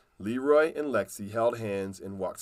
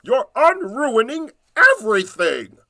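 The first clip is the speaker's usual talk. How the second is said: goblin yell; 12 semitones higher, 11 dB louder than usual. Acted. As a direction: yell it at the children. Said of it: "yelled King Giblets".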